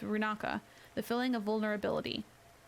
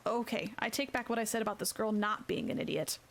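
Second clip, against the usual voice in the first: ordinary delivery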